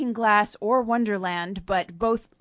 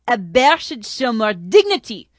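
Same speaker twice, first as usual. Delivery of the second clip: louder, chuckling sneer; chortling; 3 semitones higher, 10 dB louder than usual. Acted. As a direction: scoff with a chortle. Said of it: "chortled General Jacqueminot".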